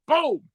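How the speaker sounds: a high, punchy boom sound; mock boom. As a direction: punch out the impact noise. Sound effect in story.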